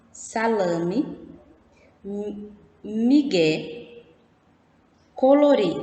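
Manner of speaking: "Salame, migué, colorê" slowly, stressed vowels closed; closed vowel sound. Shown as a demonstration. Teacher has pupils hear the words' sound, slow closed stressed vowels. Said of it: "Vamos ouvir o som das palavras".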